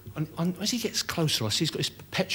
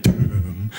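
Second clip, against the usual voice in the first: "Doom" dropped low and louder with a rising end; ominous doom; 7 semitones lower, 5 dB louder than usual. Retold or imitated. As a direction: intone "Doom" like a prophet of the end. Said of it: "as he went".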